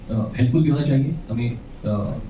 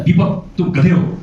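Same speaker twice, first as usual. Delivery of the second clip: slow, loud, higher jab at Deepak; harsh insult; 4 semitones higher, 5 dB louder, slower than usual. Shown as a demonstration. Teacher demos a rude insult to Deepak, slow and loud. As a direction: call him a donkey with contempt. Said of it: "मतलब, अगर बोलेंगे ना".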